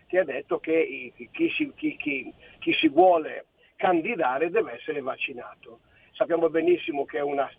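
ordinary delivery

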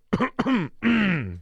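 sharp throat-clearing cough; coughing fit. Real, not acted. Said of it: "sorella tosse".

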